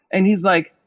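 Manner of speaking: normal talking voice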